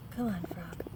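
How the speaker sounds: breathless whispered coaxing. Real, not acted. Mom whispering to the frog to move, unvoiced.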